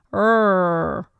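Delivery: R with the tongue curled up and back; a long, loud, curled-back "R."; curled American R. Shown as a demonstration. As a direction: stretch out the "R." with the tongue curled backwards. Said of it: "It's kinda really curling backwards".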